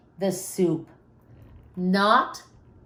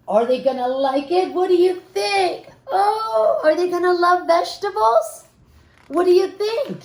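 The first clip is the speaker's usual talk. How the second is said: higher, eager questions to the listeners; suspenseful question; 8 semitones higher than usual. A narrator's reading. Reader asking children if parents will like it, excited. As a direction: lean in and ask the kids breathlessly.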